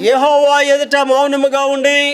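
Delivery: slow it down and lean on Jehovah, lifting the whole line; slow emphatic verse repeat. Shown as a demonstration. Preacher echoing the verse slowly for his flock.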